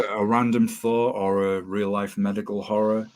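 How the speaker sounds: ordinary delivery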